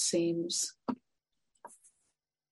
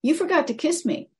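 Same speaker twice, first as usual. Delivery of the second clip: higher, louder indignant reminder; frog complaining; 9 semitones higher, 7 dB louder than usual. Acted. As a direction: remind him he forgot. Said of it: "the frog said".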